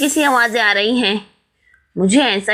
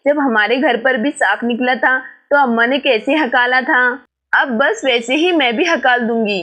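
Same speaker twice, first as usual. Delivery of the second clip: usual speaking voice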